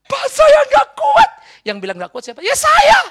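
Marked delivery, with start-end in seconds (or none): 0.1-1.3 very high, drawn-out desperate yell; imitated loud shout. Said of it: "keras sekali dia". 2.4-3.0 very high yelled retort; imitated loud shout. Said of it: "keras sekali dia".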